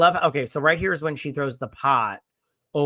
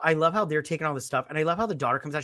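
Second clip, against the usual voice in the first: ordinary talk, nothing marked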